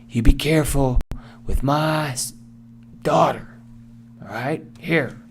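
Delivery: high, put-on voice of a protective dad; worried father's warning. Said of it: "The father and the mother worried".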